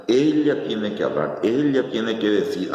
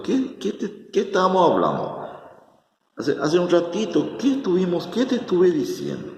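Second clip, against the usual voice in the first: much higher, exasperated teacher voice; mock exasperation; 9 semitones higher than usual. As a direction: jump up high like a teacher losing patience. Jesús exasperado con Marta. (exasperado, con la voz mucho más aguda)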